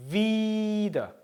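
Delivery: wieder with a drawn-out ie, vee-der; wieder, long ie; long vowel in wieder. Shown as a demonstration. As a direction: stretch the ie in wieder. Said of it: "wieder 길게 발음하죠".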